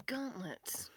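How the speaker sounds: unvoiced whisper. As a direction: breathe the word out. Whispered aside.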